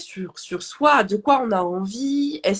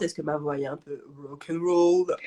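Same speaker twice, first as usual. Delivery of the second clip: rock'n'roll voice; 7 semitones lower, 4 dB quieter than usual. Shown as a demonstration. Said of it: "Ça vous fait pas penser à quelque chose".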